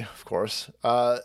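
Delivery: ordinary talk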